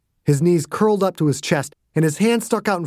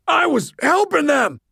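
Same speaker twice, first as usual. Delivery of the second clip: higher, raw, hoarse cry; hoarse desperate shout; 13 semitones higher than usual. Acted. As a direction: shout through a wrecked throat. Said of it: "like he gargled broken glass".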